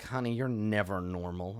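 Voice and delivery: low, soft deadpan mumble; dry gnome mumbling to her friend; mumbled. Acted. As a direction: mumble it over the shoulder. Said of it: "and she mumbles".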